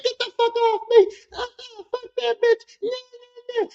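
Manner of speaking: very high, wild screeching; mock screaming. Girlfriend dragged out by bouncer, screaming wildly.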